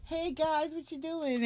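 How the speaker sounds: high, soft chirpy livestream greeting, mocked; a light mock of a cashier going live; imitating a TikTok cashier. Mocking the Walmart cashier greeting her viewers, high and soft.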